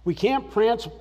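normal talking voice

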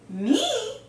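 high, loud squeak of disbelief; startled question. A narrator's reading. Skibito learns he is chosen for the job, asking in startled disbelief. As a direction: shoot the pitch up in astonishment. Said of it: "asked Skibito".

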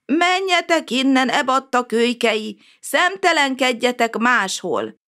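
put-on cranky neighbour barking at the children; angry shout. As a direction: shoo the rude kids away, slowing at the end. Old neighbour shouts from inside.

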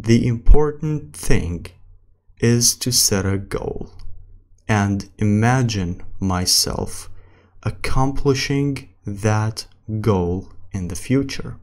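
very slow English with long pauses; slow practice reading. Shown as a demonstration. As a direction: read word by word for learners. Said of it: "السرعة الثالثة اللي هي الطبيعي".

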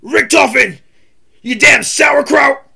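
high, loud gruff soldier yell, slowed; Tank Dempsey impression. Shown as a demonstration. Streamer showing his Tank Dempsey voice to a guest, shouted. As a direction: bark the insult like a zombie-game marine. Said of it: "he's Tank Dempsey".

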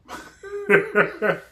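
high mock laughing; imitated laughter. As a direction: act out onlookers laughing. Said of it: "just basically laughed at him".

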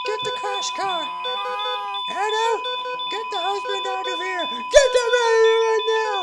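high frantic shouting; hammed-up hospital emergency; acted panic shouts. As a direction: yell for help as the wife collapses.

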